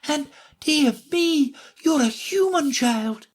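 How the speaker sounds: high fawn voice turning alarmed. A narrator's reading. Fawn realizing Alice is human, high and alarmed. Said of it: "A sudden look of alarm".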